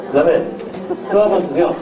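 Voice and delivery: ordinary talk, nothing marked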